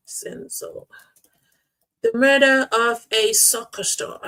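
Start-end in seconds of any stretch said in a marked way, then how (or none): 0.1-0.9 breathy aside under the breath; whisper. Real, not acted. Streamer whispering a show title to chat. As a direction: whisper the name. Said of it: "whispered aside in poll".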